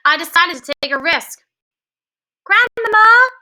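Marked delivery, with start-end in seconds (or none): 2.4-3.3 much higher, louder shout. A narrator's reading. Boy calling to his grandmother through the door, shouting. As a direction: call out as loudly as possible. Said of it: "I shouted as loudly as".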